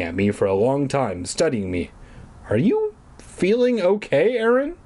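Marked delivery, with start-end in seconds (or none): 2.5-4.7 high, hesitant checking-in; concerned dad. Acted. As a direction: question lifting high with wary concern. Dad studies kid, asks with concern.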